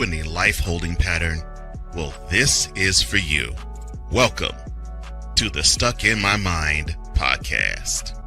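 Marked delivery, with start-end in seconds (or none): none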